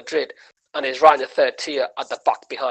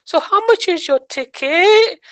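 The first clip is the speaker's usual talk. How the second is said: much higher, slow put-on voice; mock naive asking; 5 dB louder, slower than usual. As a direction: ask the tout sheepishly. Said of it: "Then I said".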